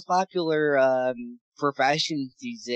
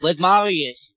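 louder, higher, punched echo; 5 semitones higher, 10 dB louder than usual. Real, not acted. Co-host echoes the joke back, loud and emphatic.

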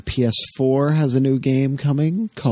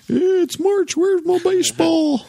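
much higher whiny baseball fan. Retold or imitated. Cohost mocking fans waiting for baseball, much higher whine. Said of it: "Everybody's been".

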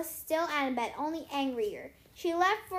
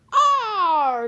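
loud, raised "arr" roar; pirate bellow; 7 semitones higher, 12 dB louder than usual. A narrator's reading. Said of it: "bellowed the big man".

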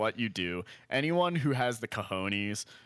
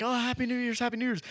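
high, singsong party greeting; cheery greeting; 11 semitones higher, faster than usual. Retold or imitated. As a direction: imitate someone breezily greeting everyone in passing. Imitating woman greeting everyone passing.

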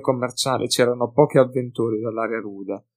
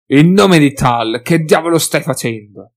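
shocked shout; 3 semitones higher, 9 dB louder than usual. Acted. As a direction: bellow at Felix in disbelief. Hef shocked at the attack.

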